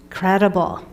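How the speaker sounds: normal talking voice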